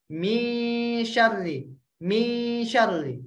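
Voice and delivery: "Mi syarri" drawn out slowly with a thin, light nasal; light ikhfa. Shown as a demonstration. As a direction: stretch "mi" before "syarri", keep it thin. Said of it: "ikhfa yang tipis".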